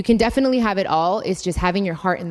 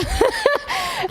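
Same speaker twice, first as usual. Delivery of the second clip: short burst of laughter up high. Real, not acted. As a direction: break into a high, delighted laugh.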